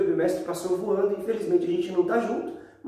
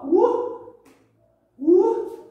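high excited yelp; excited whoop; 13 semitones higher, 5 dB louder than usual. Real, not acted. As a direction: whoop as the ball goes up. Tosses ball high while demonstrating.

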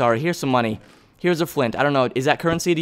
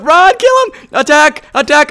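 very high, loud battle commands; urgent high yelling; frantic shout; 11 semitones higher, 6 dB louder, slower than usual. Real, not acted. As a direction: shriek orders mid-fight. Urgent fight in game.

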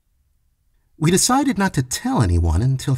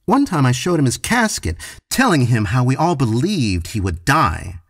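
plain reading voice

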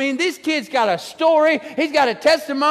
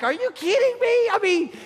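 much higher, exasperated cry; mock disbelief; 10 semitones higher than usual. Pastor reacting to Becky's spider bite, high disbelief. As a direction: act out throwing up your hands. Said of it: "I'm like".

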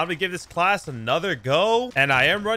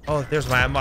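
normal talking voice

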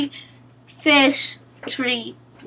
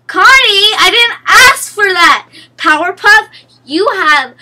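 frustrated shout; 5 semitones higher, 8 dB louder than usual. Real, not acted. Frustrated outburst in game.

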